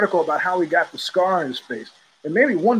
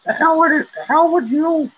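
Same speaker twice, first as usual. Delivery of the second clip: put-on much higher, louder child voice refusing; kid's tantrum; 7 semitones higher, 3 dB louder than usual. Parent imitating the crying kid's refusal in a high, loud voice. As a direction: whine it like a fed-up kid. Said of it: "She's like".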